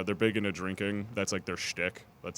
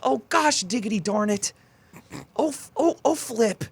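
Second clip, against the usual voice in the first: much higher, louder prim minced oaths; goody-two-shoes swearing; 13 semitones higher, 7 dB louder than usual. Pretend. Mocking the friend who never cusses, she squeals prim minced oaths. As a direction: squeal fake swears like a sweet innocent. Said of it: "Like that".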